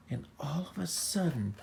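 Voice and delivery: plain reading voice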